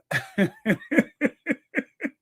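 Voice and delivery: high, helpless giggling; real high-pitched laugh. Real, not acted. Host laughing at a palace race row, high giggles. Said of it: "I'm sorry that I'm laughing".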